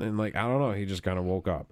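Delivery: ordinary talk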